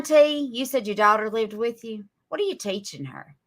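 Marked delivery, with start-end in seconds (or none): none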